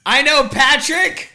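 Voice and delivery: slow, high, loud boast; hammed-up public shout; mock loud announcing. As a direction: call it out slowly to a whole theater. Acting out shouting in theater.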